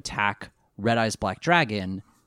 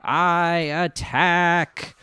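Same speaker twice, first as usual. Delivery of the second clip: mind control voice; 4 semitones higher, much slower than usual. Retold or imitated. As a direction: drag each word like a mind-controlled puppet. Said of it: "mind control voice".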